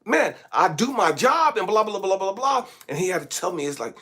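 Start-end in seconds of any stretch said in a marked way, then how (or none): none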